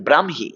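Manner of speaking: "ব্রাহ্মী" as bram-hi, the h sounded after m; correct pronunciation. Shown as a demonstration. Teacher shows students how the word goes, correctly pronounced. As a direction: model "ব্রাহ্মী" clearly, bram then hi. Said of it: "এই শব্দটার উচ্চারণ হচ্ছে এটা".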